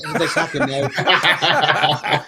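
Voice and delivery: put-on slow, laughing stab at an Irish accent; mock Irish accent. Reading a fan's comment on a podcast, laughing mock Irish accent. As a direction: drawl it out like Irish Tommy, giggling. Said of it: "do not culturally appropriate Irish Tommy's accent".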